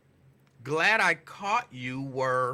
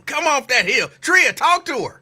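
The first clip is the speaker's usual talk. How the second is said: louder, higher call to get her told; loud urging; 8 semitones higher, 7 dB louder than usual. Real, not acted. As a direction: rise up and call out for backup. Urging caller to change.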